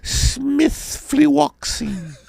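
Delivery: put-on high falsetto English accent; English mother. Mother explaining her British-flavored medication, high mock-English accent. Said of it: "talking in an English accent".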